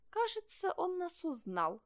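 small, high, slowing voice; fox cub. Acted. (тонкий голосок лисёнка)

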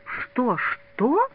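high-pitched, stunned double question; dumbfounded. A narrator's reading. Мазин stares stunned at his friend, dumbfounded. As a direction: ask it in disbelief, voice jumping up. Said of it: "Мазин остолбенело уставился на товарища".

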